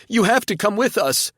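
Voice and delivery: wailing plea. A narrator's reading. Frankie still wailing, pleading.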